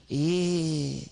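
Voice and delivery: long, held "E"; E sound. Acted. Sock repeats the letter for puppeteer, long held "E". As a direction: puppet sounding out "E" as one drawn-out vowel. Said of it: "Now say E".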